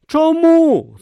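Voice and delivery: high, loud startled question; surprised. A narrator's reading. Brutha startled by Om's order. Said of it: "здивувався Брута".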